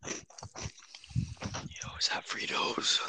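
hushed whisper, barely voiced. Real, not acted. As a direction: whisper so parents don't hear. Sneaking in parents' house at night.

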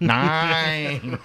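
high, loud mock German officer "nine"; German accent. Shown as a demonstration. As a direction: bark the word like a hammy German colonel. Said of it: "It just came out like".

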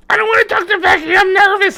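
put-on high, fast, nervous kid voice; high hurried jittery kid. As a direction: rush it out like a scared boy. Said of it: "there's a scene where he goes".

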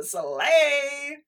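slay. Real, not acted. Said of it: "I've been saying slay".

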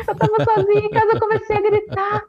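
very high, excited retelling; squealed-out excitement, acting out the moment; re-enacted screaming. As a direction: relive the screaming in a high-pitched voice.

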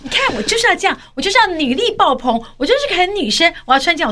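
high-pitched mimicked self-justification; mock friend's defiance. Host mimics friend defending the outfit, high defiant imitation.

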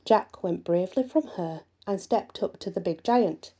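usual speaking voice

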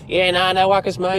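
put-on thick Australian accent, cheeky. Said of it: "didn't wanna give too much of our Australian accent away".

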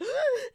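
high imitated crying gasp; mock sob, pitched high; sobbing gasp. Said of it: "And I was like".

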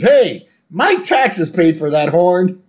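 high, loud, slow indignation; Homer protesting. Acted. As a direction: protest loudly as an outraged Homer. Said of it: "And then Homer goes".

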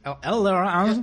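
slow mock Australian drawl on e-learning; laconic outback drawl. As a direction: drag out e-learning in an Aussie drawl. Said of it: "tenemos acento australiano".

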